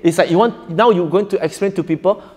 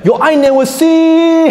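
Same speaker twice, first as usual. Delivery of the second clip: higher, slow, every vowel drawn long; mock stretched recitation; 12 semitones higher, 5 dB louder, slower than usual. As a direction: drag out each vowel. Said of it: "You make it harakat longer".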